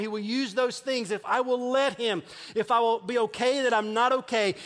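loud, rising preaching; full-force preaching. Acted. Pastor preaching God's care to the congregation, fervent.

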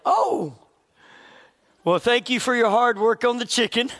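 sheepish laughing surprise; embarrassed surprise. Retold or imitated. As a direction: caught off guard, cover it with a laugh. Stunned that she knows him.

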